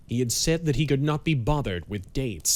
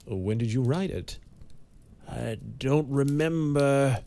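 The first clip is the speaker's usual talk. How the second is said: slow and pained; 3 semitones higher than usual. A narrator's reading. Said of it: "Banks spoke slowly and painfully".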